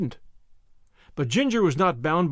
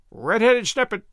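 parrot's scornful insult, raised pitch; profound contempt; 5 semitones higher, 4 dB louder than usual. Acted. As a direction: spit out a mildly contemptuous jibe. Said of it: "in a tone of profound contempt".

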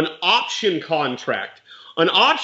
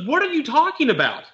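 much higher, incredulous question; retold bewilderment; 9 semitones higher than usual. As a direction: imitate a seller with no idea what's meant. Retold seller's baffled reply.

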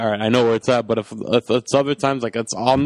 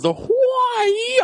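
drawn-out, high mock yell; Schwarzenegger; much slower than usual. Host mocking the actor's karate-move moment, hammy yell. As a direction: wail it slowly like a hammy action star. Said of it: "and he goes".

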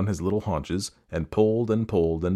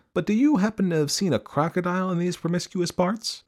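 put-on high, courteous child character; most polite; 9 semitones higher than usual. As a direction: open politely in a young elephant's high voice. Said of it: "said the elephant's child most politely".

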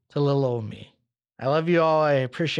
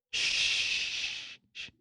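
hammed-up shushing whisper; mock hushing; 8 dB quieter than usual. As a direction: hush the audience melodramatically. Mock tender farewell to listeners.

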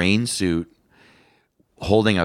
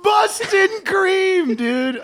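high laughing cry on Boston cream; shrieking with laughter at the pun; a friend cracking up at a pun, shrieking with laughter; much slower than usual. Real, not acted.

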